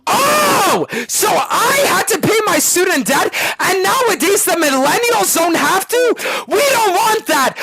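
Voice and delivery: mock whining objector. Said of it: "Hey guys, the U S wants to cancel student debt".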